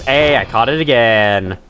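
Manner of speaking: ordinary talk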